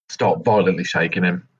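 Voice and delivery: ordinary talk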